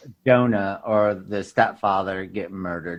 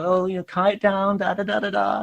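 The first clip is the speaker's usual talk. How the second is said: high, soft, rattled-off parental pleading; fretting parent; 8 semitones higher, 5 dB quieter than usual. Retold or imitated. Parent chasing after kids, imitated high fretting. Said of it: "their parents are like, ... chasing them".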